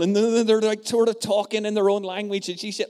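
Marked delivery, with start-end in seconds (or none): none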